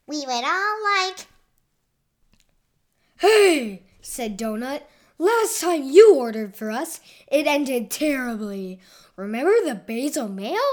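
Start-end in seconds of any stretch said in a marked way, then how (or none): 0.1-1.3 raised, louder eager voice; Noodle piping up. A narrator's reading. Noodle starting to order for the crew, piping up. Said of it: "Noodle piped up". 3.2-3.8 sharp, high, loud protest; loud interruption. A narrator's reading. Donut stopping Noodle from ordering, loud interruption. 5.2-10.7 loud, emphatic reproach; indignant objection. A narrator's reading. Donut objecting to Noodle ordering for everyone, indignant.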